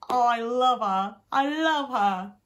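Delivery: loud, drawn-out adoring gush; gushing affection. Real, not acted. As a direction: gush slowly and emphatically, pitch lifting. Gushing over favourite character Tina.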